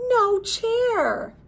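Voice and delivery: high surprised no chair; surprised punchline. Acted. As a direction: land the gag with a high lift. Wand trick punchline.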